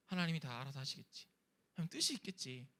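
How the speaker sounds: low, soft self-reassurance; calmed resignation. Acted. Believer settling down and trusting God, calmed resignation. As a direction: let go quietly after the outburst. Acted calming down after outburst.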